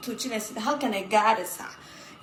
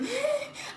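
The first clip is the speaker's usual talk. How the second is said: sharp high gasp; mock gasp; 3 dB quieter than usual. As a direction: gasp in fake shock. Mocking gasp, pitch jump.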